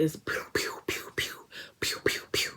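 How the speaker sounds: whispered pew pew; breathy firework sound effects; fireworks noise. Retold or imitated. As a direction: mimic little fireworks popping. Imitating fireworks.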